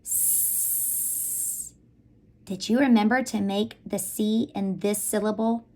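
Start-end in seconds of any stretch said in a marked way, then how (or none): 0.0-1.7 "s" as one long voiceless hiss; soft C sound. Shown as a demonstration. Teacher showing the class soft C, long hissed "s". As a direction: hold "s" out, no vowel. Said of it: "to its soft sound that says".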